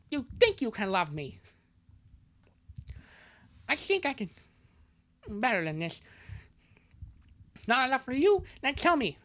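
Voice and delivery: high, lisping cartoon girlfriend; hammed-up, badly faked lovelorn squeak; Bubsy. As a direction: plead in a silly high cartoon voice. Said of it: "I'm gonna change her voice".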